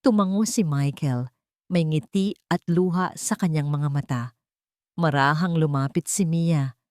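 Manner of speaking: plain reading voice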